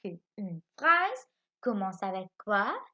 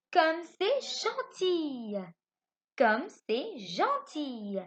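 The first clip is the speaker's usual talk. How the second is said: lecture lente; 4 semitones higher, much slower than usual. Shown as a demonstration. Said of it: "Il dit".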